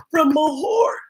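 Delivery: ordinary delivery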